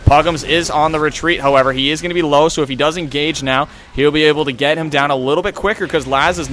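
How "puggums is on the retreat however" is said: ordinary delivery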